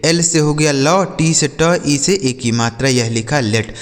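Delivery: ordinary talk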